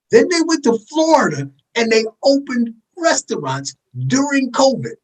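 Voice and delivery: high, slow and spaced-out for emphasis; deliberately slow. Real, not acted. Driving home the Florida restaurant irony, slow, high and deliberate. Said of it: "Let me say this slowly".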